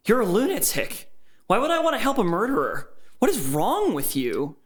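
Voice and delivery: high-pitched incredulous outrage; outraged. Acted. Captive refusing to help a murderer, high indignant outburst. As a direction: throw it back at him, pitched high. Victim confronts murderer in story.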